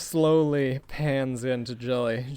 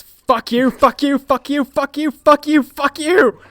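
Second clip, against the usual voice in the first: building, high, loud scream of rage; 13 semitones higher, 11 dB louder than usual. Acted. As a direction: hurl each one harder than the last. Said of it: "just screams".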